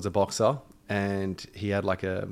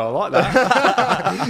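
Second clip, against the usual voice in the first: high, slow laugh through it; 9 semitones higher, 7 dB louder, much slower than usual. Real, not acted. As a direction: laugh while saying it. Laughs at the flattering intro.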